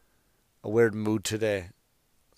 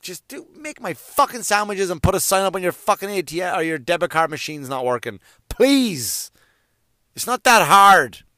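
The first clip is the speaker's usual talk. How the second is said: exasperated rant; 7 semitones higher, 8 dB louder than usual. Retold or imitated. Ranting at sandwich shop staff.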